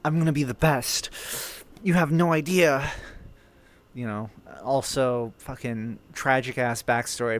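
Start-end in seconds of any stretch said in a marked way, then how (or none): 0.0-2.9 lightly voiced eager anime hero; loud, fast hyped-up shonen boast; Ash Ketchum. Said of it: "he's playing Ash Ketchum".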